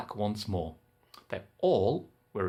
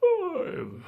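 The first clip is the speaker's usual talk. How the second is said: a high squeaky oh from the giant; high startled oh; giant's surprised oh. Acted. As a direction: let it pop up high. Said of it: "And the giant said".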